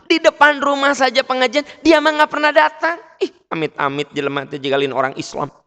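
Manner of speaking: high, loud imitation of a tattling neighbour; gossiping complainer. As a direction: act out someone indignantly telling on a neighbour. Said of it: "Mirip seperti ada orang gini". (menirukan tukang komen yang heboh)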